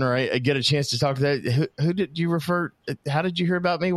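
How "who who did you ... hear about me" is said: high, puzzled questioning; curious. Retold or imitated. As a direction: ask like you're baffled. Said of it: "I call that person".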